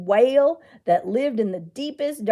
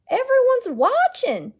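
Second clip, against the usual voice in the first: put-on high, louder matter-of-fact child; with authority; 13 semitones higher, 6 dB louder than usual. Boy explaining to his aunt, with childish authority. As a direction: state it like he knows best. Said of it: "Eli answered with authority".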